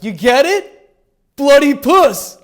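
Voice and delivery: loud, high-pitched costumed jokester; costumed party guy. Acted. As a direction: shout the punchline at the party crowd, fishing for laughs. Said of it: "this guy walking around a Halloween party going like".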